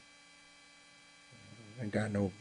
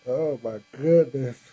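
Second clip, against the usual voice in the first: slow and louder, said through a real yawn; 4 semitones higher, 7 dB louder, slower than usual. Real, not acted. Host yawning to his live viewers after a long day, words through a yawn. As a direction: let the words stretch out mid-yawn. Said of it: "Excuse me for yawning".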